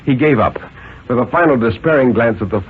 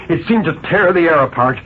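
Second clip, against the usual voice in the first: ordinary delivery, nothing marked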